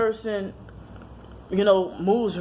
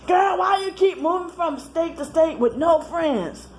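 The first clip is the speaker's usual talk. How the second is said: mocking brother; 11 semitones higher than usual. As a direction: mimic the brother on the phone. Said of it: "he got on the phone".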